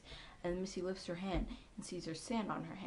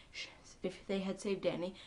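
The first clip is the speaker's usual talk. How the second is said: ordinary talk, nothing marked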